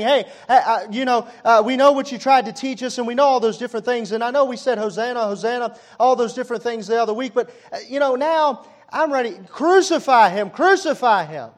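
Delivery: high, loud jeering crowd with a laugh; mocking crowd. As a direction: taunt him like the crowd calling for crucifixion. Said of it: "And they're mocking him".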